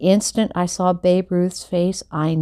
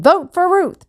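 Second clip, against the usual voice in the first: high, loud chant; rallying call; 11 semitones higher, 5 dB louder than usual. A narrator's reading. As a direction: pump up the crowd. Babe rallying the crowd.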